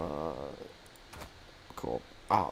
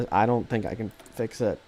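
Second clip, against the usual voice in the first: ordinary talk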